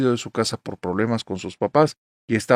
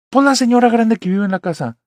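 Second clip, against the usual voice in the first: insistent explanation; 10 semitones higher, 8 dB louder than usual. Retold or imitated. Brother insists to mom.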